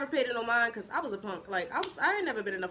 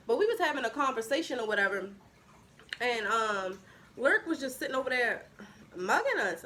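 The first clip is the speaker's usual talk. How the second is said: ordinary talk, nothing marked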